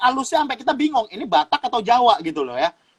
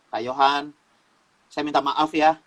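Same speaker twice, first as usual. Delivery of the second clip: humble apology; 6 semitones lower than usual. Retold or imitated.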